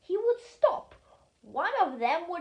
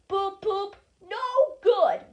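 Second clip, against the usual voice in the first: grumbling; 6 semitones higher, 6 dB louder, slower than usual. A narrator's reading. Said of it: "grumbled Gordon".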